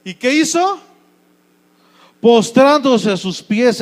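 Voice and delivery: usual speaking voice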